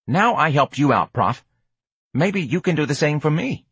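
streetwise hustler, wheedling. Acted. George asks a favor in return, wheedling.

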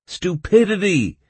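loud, high blurt; high, punchy interjection; interrupting. A narrator's reading. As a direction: cut in emphatically. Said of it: "interrupted Baum".

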